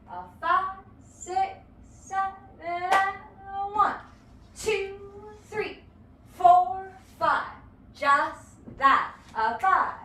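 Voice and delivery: rhythmic teacher count, four punched loud; dance count-in. Shown as a demonstration. Dance teacher counting the class in, loud on four. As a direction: count the class in with a driving beat. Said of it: "Let's try that a couple times".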